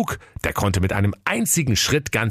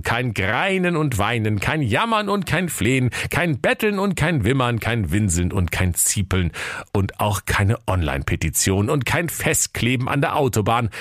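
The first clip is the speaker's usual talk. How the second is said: usual speaking voice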